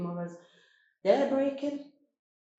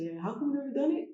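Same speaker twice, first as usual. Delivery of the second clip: quick, higher, laughing; laughing, faster taunt; laughing tease; 7 semitones higher, faster than usual. Retold or imitated. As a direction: tease him through a laugh. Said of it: "He goes".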